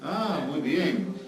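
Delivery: ordinary delivery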